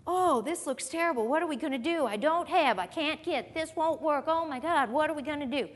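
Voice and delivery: high, anxious fretting; mock panic. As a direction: act out a worried complainer, hammed-up. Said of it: "Here's what we do".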